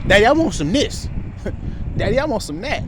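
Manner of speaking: put-on high childlike asking voice; young son nagging for things in a high voice; child asking. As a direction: ask like a little kid wanting treats. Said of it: "You know that's how you talk".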